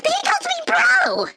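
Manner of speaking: very high, loud, drawn-out shriek; outraged screech. Acted. Woman offended at being called bro, outraged screech. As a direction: shriek it in disbelief.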